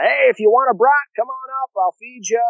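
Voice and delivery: high-pitched mock call from a hilltop; imitated yell. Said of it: "he yells down".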